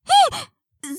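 sudden high startled cry; a sharp startled yelp; shocked. Acted. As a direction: jump at the surprise. Shocked at teacher's demand.

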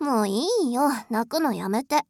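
ordinary talk, nothing marked

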